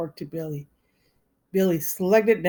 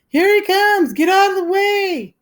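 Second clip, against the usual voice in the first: high, loud panicked yell; 14 semitones higher, 9 dB louder than usual. Acted. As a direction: shout a warning while fleeing.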